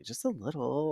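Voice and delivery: small, sheepish voice with a rising end; embarrassed. Retold or imitated. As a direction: imitate someone shrinking with embarrassment. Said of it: "she's embarrassed in front of Kendra".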